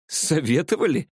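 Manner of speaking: incredulous, amused query; smirking. A narrator's reading. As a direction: throw the word back with a smirk. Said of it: "ухмыльнулся я".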